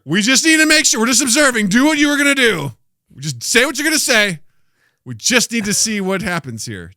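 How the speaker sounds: high, loud mock outburst; exasperated yelling. Time traveller arguing with his past self, exasperated shouting. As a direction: shout it over the past self's protests.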